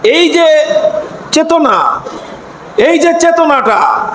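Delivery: shouted sermon cadence; fiery preaching. Acted. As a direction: preach it out at full force. Preaching at full force. (জোরালো ওয়াজের সুরে চিৎকার)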